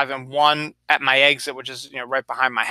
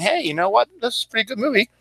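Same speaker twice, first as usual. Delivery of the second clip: higher, pleased little discovery; pleasantly surprised viewer; 9 semitones higher, faster than usual. Pretend. A viewer at home reacts to Roma, pleasantly surprised. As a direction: sound like you just found a gem on the couch. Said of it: "instead of saying".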